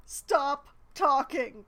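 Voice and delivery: loud, slow stop talking; exasperated shout. Real, not acted. Streamer yelling at the chatty NPC, fed up. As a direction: drag it out, fed up.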